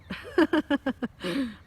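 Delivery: loud, high burst of giggling; real fit of laughter; giggles. Real, not acted. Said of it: "then I got the giggles".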